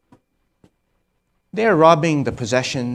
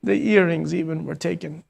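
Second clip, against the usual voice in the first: higher, tear-choked voice; 6 semitones higher than usual. Real, not acted. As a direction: get it out through held-back crying. Still weeping after breakdown.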